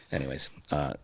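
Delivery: usual speaking voice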